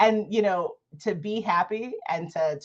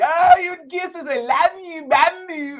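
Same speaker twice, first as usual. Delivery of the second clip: high, slow cooing to a little one; loving mom baby talk; 11 semitones higher, 6 dB louder, slower than usual. Retold or imitated. Mom cooing at her child, high and tender. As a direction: coo over the child like a doting mom. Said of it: "Loving, dovey".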